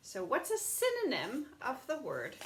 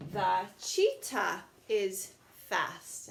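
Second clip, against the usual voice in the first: very slow, drawn out reading; slow model sentence; much slower than usual. Shown as a demonstration. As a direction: read the finished sentence slowly to the class. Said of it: "so let's try that".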